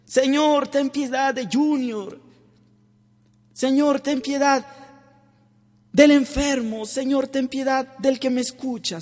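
high, loud repeated cry for mercy; tax collector's plea. Retold or imitated. Preacher voicing the publican begging mercy, high impassioned plea. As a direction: plead Señor again and again, intensely. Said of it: "Murmuraba también un publicano".

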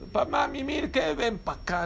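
high-pitched imitation of a mother; lightly voiced scolding mum; mock mother scolding. Mother summons muddy child in story.

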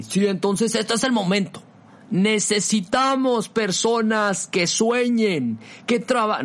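high, slow rousing speaker voice; motivational speaker voice. Pretend. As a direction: pump up the crowd like a motivational speaker. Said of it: "voy a poner mi voz de conferencista motivacional".

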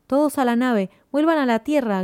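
urgent, high-pitched command; shouted order. A narrator's reading.

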